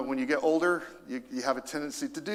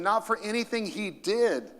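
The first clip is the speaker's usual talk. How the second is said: ordinary delivery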